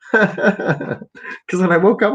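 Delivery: slow words through a laugh. Real, not acted. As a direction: laugh while telling it.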